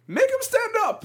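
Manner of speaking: high-pitched mock scream, like a whiny villain; bitchy screaming voice. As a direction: screech it out as a bitchy-voiced imitation. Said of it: "he was screaming in this, like, bitchy voice".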